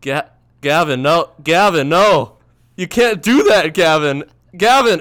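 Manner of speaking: high, very loud cries to stop; retold alarmed scolding. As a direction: act out yelling at a roommate to stop. Acts out pleading with drunk roommate.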